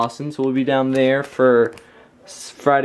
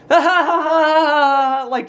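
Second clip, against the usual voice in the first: sleep giggling; 11 semitones higher, 3 dB louder than usual. Retold or imitated. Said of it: "just laughing in her sleep".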